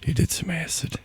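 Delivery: hushed unvoiced whisper. Real, not acted. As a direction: breathe it out, alarmed. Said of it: "Why are we whispering?".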